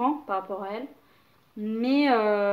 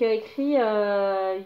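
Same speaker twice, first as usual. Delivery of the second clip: normal talking voice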